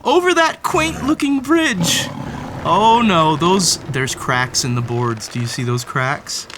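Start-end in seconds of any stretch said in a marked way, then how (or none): none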